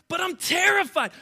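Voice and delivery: high, loud frightened shout; frightened Peter crying out to Jesus; terrified cry. Retold or imitated. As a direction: cry out in panic as Peter sinking. Peter afraid on the water.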